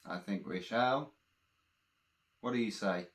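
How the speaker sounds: plain reading voice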